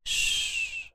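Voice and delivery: soft breathy hush; shushing. Acted. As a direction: hush them gently to sleep. Hushing the listener.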